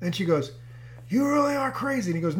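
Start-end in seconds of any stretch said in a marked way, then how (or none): 1.1-2.1 high-pitched incredulous verdict; mock disbelief. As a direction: play the wife who can't believe him. Wife's disbelief acted out.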